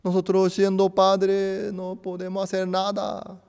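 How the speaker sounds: put-on urgent prayer for the unborn child; retold prayer. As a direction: beg the Lord for the baby's health, rising loud. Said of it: "no pudimos menos que orar al Señor".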